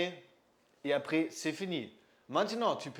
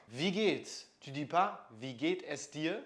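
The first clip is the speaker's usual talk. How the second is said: ordinary delivery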